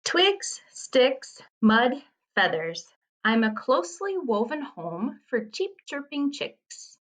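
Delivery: high-pitched, lilting haiku reading; poem reading. Acted. Teacher reads a riddle about a nest, poem reading. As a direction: space out the list, then lilt onward.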